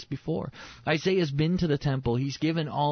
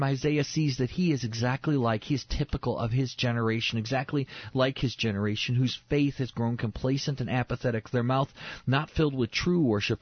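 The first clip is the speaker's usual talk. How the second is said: normal talking voice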